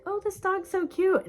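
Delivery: high squeaky voice for a cute dog; high cooing pet voice; cutesy baby talk. Retold or imitated. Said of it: "I used to think it'd be me being like".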